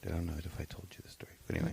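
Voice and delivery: quick whisper under the breath; muttered aside to his wife, whispered. Real, not acted. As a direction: drop to a rushed whisper for the aside. Aside while starting the story.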